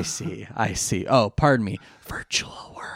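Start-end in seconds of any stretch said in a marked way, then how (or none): 2.1-2.9 hushed aside; whisper. Real, not acted. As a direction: whisper it to yourself.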